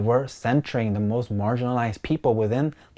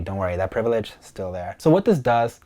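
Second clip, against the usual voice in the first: normal talking voice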